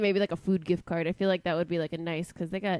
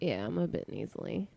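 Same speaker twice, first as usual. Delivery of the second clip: normal talking voice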